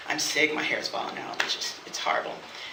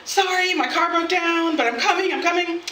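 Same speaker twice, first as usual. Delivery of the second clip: high-pitched imitation of a latecomer; flustered apology; 10 semitones higher than usual. Date half an hour late apologising, imitated fluster. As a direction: plead in a raised, hurried-sounding voice.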